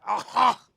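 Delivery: loud hacking dog cough; dog cough imitation. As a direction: bark out a sharp retching cough. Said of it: "got a dog goes".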